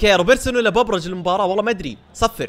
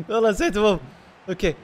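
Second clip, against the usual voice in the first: ordinary talk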